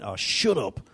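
mock-annoyed, laughing brush-off; joking dismissal. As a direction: cut them off, only for a laugh. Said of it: "For a laugh. It d-, wasn't being serious".

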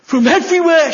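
high, loud, thrilled retelling; excited exclaim. Retold or imitated. Preacher voicing healed woman, thrilled and loud. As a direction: burst out with her joy.

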